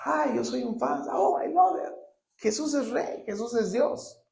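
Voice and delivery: lightly mocking high fan voice; a gushing fan, hammed-up lightly. Pastor mocking churchgoers who are only fans, high and gushing. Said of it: "Hay muchos que son fans".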